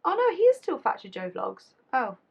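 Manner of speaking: usual speaking voice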